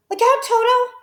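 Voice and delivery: high, loud urgent yell; warning shout. A narrator's reading. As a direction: shout a warning to save him. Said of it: "shouted Humpy Rumpy".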